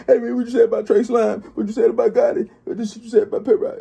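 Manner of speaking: high, pushy mock accusation; confronting rival. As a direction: needle him like the Memphis rivals would. Imitating Memphis rivals confronting him.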